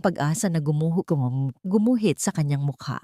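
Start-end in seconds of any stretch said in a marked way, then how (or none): none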